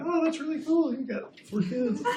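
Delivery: very high, laughing fake enthusiasm; hammed-up high-pitched polite delight; mock amazement. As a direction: squeak out an awkward, over-impressed reaction. Retold awkward reply, laughing at himself.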